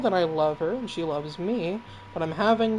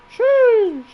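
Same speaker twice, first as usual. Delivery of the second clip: shrill exasperated sheesh; exasperated exclamation; 11 dB louder than usual. Acted. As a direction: blurt it out loud and high. Startled exclamation, huge jump.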